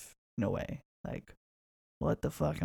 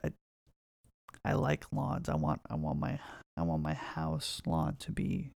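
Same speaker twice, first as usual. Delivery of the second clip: ordinary talk, nothing marked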